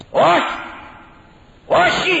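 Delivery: high, loud shout; acted defiant refusal. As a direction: shout down the crowd as a leader. Acts out young politician's refusal. (δυνατή κραυγή άρνησης)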